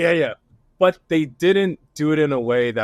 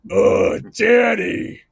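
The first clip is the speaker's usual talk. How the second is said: drawn-out, higher-pitched mock shout of a name; 8 semitones higher, 4 dB louder, much slower than usual. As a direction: holler it slowly, stretched right out.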